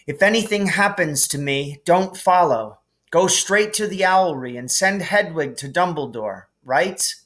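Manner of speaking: lightly voiced boy, urgent; firm instructions to a friend; understated urgent boy; Harry voice. A narrator's reading.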